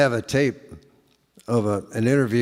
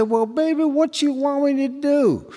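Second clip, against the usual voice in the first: much higher, exasperated pleading; a high whining "what you want me to do"; high exasperated plea; 12 semitones higher than usual. Retold or imitated. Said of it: "And I said".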